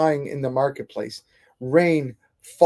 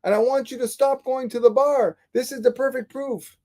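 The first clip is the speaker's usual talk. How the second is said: much higher, pleading in disbelief; son's exasperated higher cry to his father; disbelieving protest; 9 semitones higher than usual. Retold or imitated. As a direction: plead with your father, stunned he missed the point. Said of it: "his son couldn't believe it".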